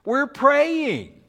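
high, loud, fed-up outburst; acted indignant telling-off; exasperated scolding. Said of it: "somebody stands up and says".

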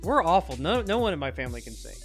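ordinary talk

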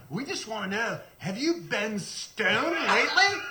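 mock the kid's punchline; teen prank caller. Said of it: "And he goes".

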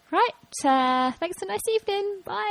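high, quick goodbye, ending the date; brisk brush-off. Retold or imitated. To the four-year-old date at evening's end, a high brush-off. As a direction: chirpily cut him off and leave. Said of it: "and then I was like".

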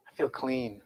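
very soft, low retold confession; hushed repeat for effect. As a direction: let it land quietly, almost under the breath. Boy's words repeated softly for effect.

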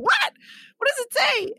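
high, loud mock shriek of surprise; shocked squeal. Retelling grabbing the letter from Jenna, high loud squeal. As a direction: squeal what does it say at her. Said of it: "I'd rip it, like".

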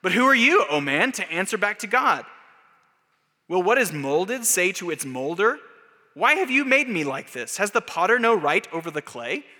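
high, loud rhetorical challenge; Paul's stern rebuke. Retold or imitated. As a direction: thunder the apostle's question back at man. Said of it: "I consider Paul in Romans nine".

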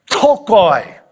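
"ptochoi" with a spitting burst on the opening pt; punchy Greek word. Shown as a demonstration. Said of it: "a little bit more of a punch".

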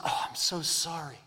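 softly voiced humble apology. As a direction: imitate a mildly contrite sorry. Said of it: "They just humbly apologize".

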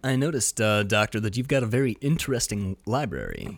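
low, soft, measured hero voice; Tom Harris. Acted. Movie hero questioning the doctor about his library, low soft impression. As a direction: drop low and quiet, politely probing. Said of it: "I do my Tom Harris voice".

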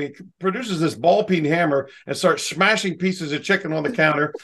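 ordinary talk, nothing marked

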